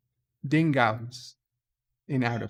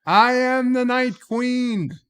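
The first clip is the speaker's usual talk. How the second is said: high, loud, hammed-up cry; triumphant proclamation; 12 semitones higher, 7 dB louder than usual. Captain proclaiming himself after the killing, loud and triumphant. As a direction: belt it out, stretching every word. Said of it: "And he says".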